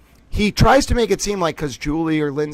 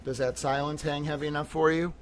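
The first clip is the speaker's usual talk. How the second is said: softer, subdued and grave; forced calm; 2 semitones lower, 9 dB quieter than usual. Real, not acted. To the viewers asking for support, quiet restrained anger. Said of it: "I'm gonna calm down".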